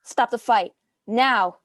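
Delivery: loud, urgent command to stop; sharp order. Acted. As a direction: order it stopped now. Honoka demands fight be stopped.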